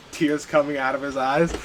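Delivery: ordinary delivery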